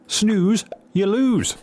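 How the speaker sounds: high, sing-out gloating jibe; mock taunt. Game character gloating over a rival for the job, mock taunting. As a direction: crow it at the loser in a high voice.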